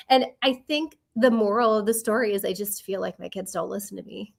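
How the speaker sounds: usual speaking voice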